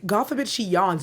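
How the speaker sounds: usual speaking voice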